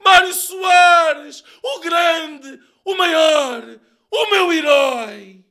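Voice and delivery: drawn-out, high, loud cries of adoration; tearful hero-worship, shouted high; ecstatic shout. A narrator's reading. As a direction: cry out praise slowly at full voice. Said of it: "Já gritava Sócrates".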